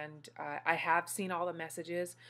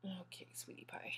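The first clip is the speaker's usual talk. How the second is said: whisper; 13 dB quieter than usual. Real, not acted. Soothing newborn.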